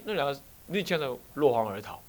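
ordinary delivery

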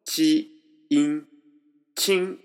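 チ and イン apart, then joined as a high チン; チン held high and level in first tone; blending syllables. Shown as a demonstration. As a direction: model チ, イン, then blend them into チン. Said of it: "チとインと一緒に発音すると".

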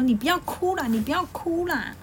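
high, flustered pleading voice; flustered brothers. Acted. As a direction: coax the crying boy, rattled. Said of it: "反而有点慌张". (慌张地哄人别哭)

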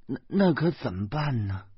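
lightly voiced old woman, fretting; a hint of a fussing old mum, slowing on the tiger; flustered old mother. Old mother fretting about tigers, lightly voiced and flustered. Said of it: "老妈慌张地说".

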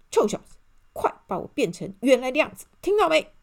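loud, hurried, angry order; furious scolding. A narrator's reading. Mr. Arrogant berates the elf at the castle, furious. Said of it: "他气冲冲地骂".

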